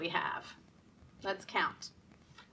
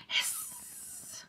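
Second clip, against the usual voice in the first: breathy hissing snake; 6 dB quieter than usual. Pretend. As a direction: hiss like a snake. Book animal sound.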